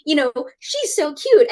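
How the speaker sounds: high, slow cooing; cooing over a cat. Retold or imitated. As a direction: coo at a cute cat on screen. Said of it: "then we'd be like".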